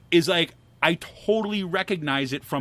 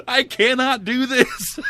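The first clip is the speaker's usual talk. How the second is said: mock defeated; 7 semitones higher, 5 dB louder, slower than usual. Retold defeat over hand grinder.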